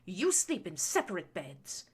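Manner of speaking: sharp, loud, furious scolding; snapped. A narrator's reading. Baroness snapping at her son over separate beds. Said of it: "she snapped".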